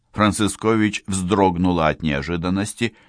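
usual speaking voice

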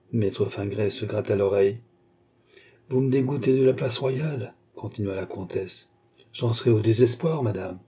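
plain reading voice

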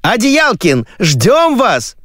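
high, insistent phone cry; shouting woman. Acted. TV woman summoning him into his other ear, shouting. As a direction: call out high and urgently. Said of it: "кричала женщина в другое моё ухо".

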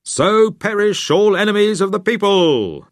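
loud, high proclamation; rallying cry to the crowd; sudden shout. Acted. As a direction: bellow it like a revolutionary. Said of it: "Ralph suddenly shouted".

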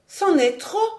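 high, outraged protest; indignant. A narrator's reading. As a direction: protest hotly, pitch shooting up. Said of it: "Alix se leva indignée".

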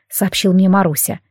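ordinary delivery

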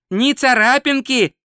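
high, cheerful exclamation. Acted. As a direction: announce it with bright delight. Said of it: "жизнерадостно констатировал Два Цветок".

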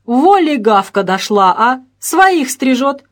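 loud, drawn-out mocking exclamation; amused jeer. A narrator's reading. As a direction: jeer it out slowly, tickled by the news. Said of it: "и расхохотался". (насмешливо, громко и протяжно)

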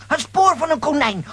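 normal talking voice

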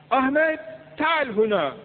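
Arapça, yüksek ve tiz bir seslenme; uzaktan çağırma. Acted. (Ahmet'i Arapça yanına çağıran biri gibi yüksek sesle)

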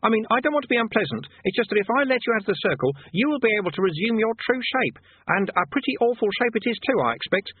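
high, nervous-friendly conjurer; conjurer explaining. Acted. Conjurer explaining the circle to Rincewind, high and fussy. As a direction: reason with the demon.

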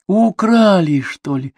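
high startled exclamation, dropping in volume at the end; gasped question. A narrator's reading. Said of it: "ахнула Клеопатра". (ахнув, высоко и удивлённо)

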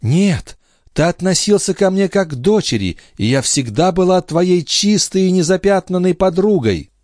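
put-on very high, earnest young wife's voice; devoted Lorenza. A narrator's reading. Lorenza vouches for her husband before the princess, devoted. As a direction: protest devotion in a high, fervent voice.

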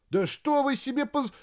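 high, cut-off indignant protest; indignant outburst. Acted. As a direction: start a protest and get cut off. (возмущённо, высоко)

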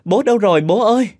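high, loud calls into the dark house; frantic calling out. Acted. Thúy calling out for her father, frightened and loud. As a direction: call out for father in panic.

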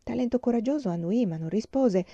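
ordinary delivery, nothing marked

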